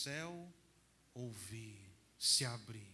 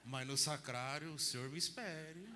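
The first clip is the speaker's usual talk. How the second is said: ordinary talk, nothing marked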